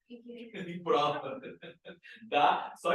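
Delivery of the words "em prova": "Em prova" stretched slowly, word by word, with a laugh; slow drawn-out repeat. Shown as a demonstration. Teacher stretching it out so the class hears it.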